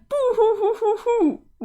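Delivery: mock boo-hoo crying. Sprite mourning her lost friends.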